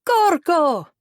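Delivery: loud, high-pitched yell; high, festive holler; yelled cheer. A narrator's reading. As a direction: shout it like a whole room cheering. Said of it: "The room yelled".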